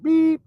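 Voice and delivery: a high loud beep, like a bleep; mock TV bleep sound; censor bleep. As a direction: squeal a sharp censor beep. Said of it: "it's like a cuss word".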